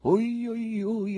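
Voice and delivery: high, acted wail of pain; suffering. Someone in the silent theatre laments, suffering. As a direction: moan it out high and drawn. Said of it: "esclamò qualcuno con voce sofferente".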